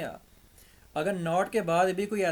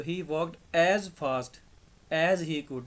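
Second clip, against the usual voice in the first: "as fast as" slow and louder, stress on "as"; corrected answer read out; 6 dB louder, much slower than usual. Shown as a demonstration. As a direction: read the fixed sentence slowly with weight on "as". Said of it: "हमें तो positive degree चाहिए". ("as" पर ज़ोर देकर धीमे से)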